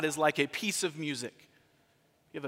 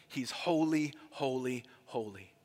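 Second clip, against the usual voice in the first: each HOLY set apart, dropping lower and softer; emphatic repetition; 4 semitones lower, 4 dB quieter than usual. Shown as a demonstration. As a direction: let HOLY sink lower and quieter with every repeat. Builds emphasis by repeating holy.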